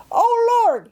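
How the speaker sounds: loud, very high Cockney wail; high-pitched, hammed-up cry of distress; panicked cry. A narrator's reading. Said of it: "he cried".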